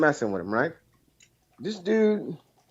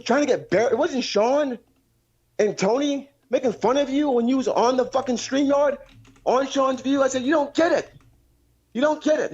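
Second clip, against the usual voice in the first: higher, pushed comeback; retold heated retort; 11 semitones higher than usual. Caller replaying how he snapped back at his friend, raised and heated. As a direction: fire back at him, worked up.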